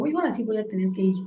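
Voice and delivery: quick resigned aside under the breath; muttered to herself. Retold or imitated. Se dice a sí misma. (murmurado para sí misma, rápido)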